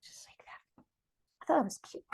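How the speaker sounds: soft unvoiced whisper. Real, not acted. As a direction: murmur it to yourself in a whisper. Whispered aside while drawing.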